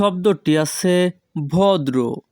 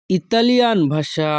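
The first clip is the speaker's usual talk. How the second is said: usual speaking voice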